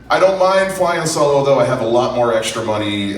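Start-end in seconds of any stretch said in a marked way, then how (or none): none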